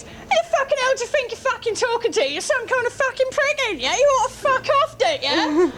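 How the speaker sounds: high-pitched mock screeching tirade; shrill sweary outburst, played for laughs; imitated angry rant. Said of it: "It kind of goes like this".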